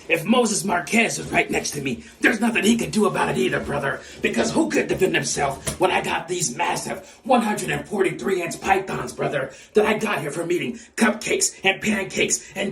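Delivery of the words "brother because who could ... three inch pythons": ordinary talk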